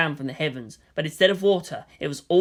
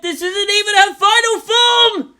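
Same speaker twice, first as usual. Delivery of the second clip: much higher, louder terrified shout; panicked scream; 9 dB louder than usual. Acted. Soldier screaming over the radio, panicked. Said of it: "someone screamed over the radio".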